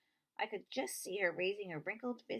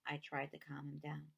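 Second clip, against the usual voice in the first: plain reading voice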